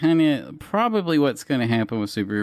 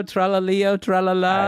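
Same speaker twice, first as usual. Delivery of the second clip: put-on high-pitched, playful Italian accent; mock Italian accent; 6 semitones higher than usual. Quizzing a co-host on kids' meme names, mock Italian accent. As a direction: lilt it like a cartoon Italian. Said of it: "It's never racist to do an Italian accent".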